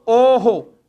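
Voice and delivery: ओहो said as o with an echoing ho; teacher sounding out the visarga echo; visarga sound demo. Shown as a demonstration. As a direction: let the visarga in ओहो copy the o vowel. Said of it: "हो की ध्वनि आ रही है".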